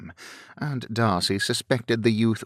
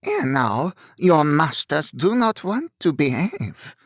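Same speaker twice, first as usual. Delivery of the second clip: put-on higher, louder French-accented second robber; roughened French accent; 7 semitones higher, 4 dB louder than usual. A narrator's reading. Second robber addresses the coachman, roughened French accent. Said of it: "another roughened, French-accented voice".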